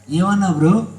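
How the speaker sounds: ordinary talk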